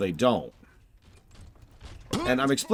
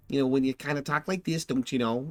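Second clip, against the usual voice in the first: a light, halfhearted Michigan accent with a lilt up on "know"; a loose try at a Upper Midwest Michigan twang; 5 semitones higher than usual. Shown as a demonstration. Gamer demoing a Michigan accent for the soldiers, lightly done. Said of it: "Michigan or Wisconsin kind of an accent".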